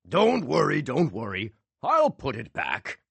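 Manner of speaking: joking magician. A narrator's reading. Magician teasing the alarmed guard, mock reassurance. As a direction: reassure with a wink.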